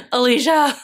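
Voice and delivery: high squealed name then a laugh; excited squeal of the maker's name; laughing call-out. Real, not acted. Calls out the kit maker.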